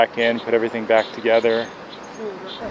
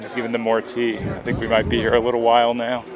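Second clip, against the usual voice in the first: ordinary delivery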